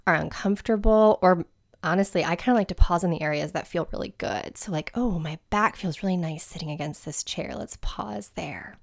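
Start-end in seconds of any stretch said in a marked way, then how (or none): 4.9-8.8 hushed, half-whispered thought to yourself; soft inner voice. Pretend. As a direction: murmur it softly, as if noticing a pleasant feeling. Voices own contented thought while meditating.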